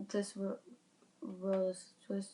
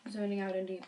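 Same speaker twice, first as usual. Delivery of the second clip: ordinary delivery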